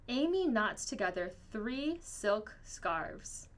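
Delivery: usual speaking voice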